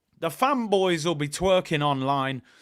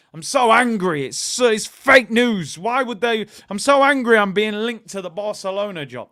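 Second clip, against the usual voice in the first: put-on louder angry rant; mock fuming; 3 semitones higher, 7 dB louder than usual. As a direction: play-act being furious for the press. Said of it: "you act like you're fuming".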